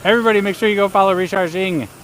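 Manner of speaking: high, loud call to viewers; rally the viewers with a shout; loud shoutout. Real, not acted. Announcing a shoutout to chat.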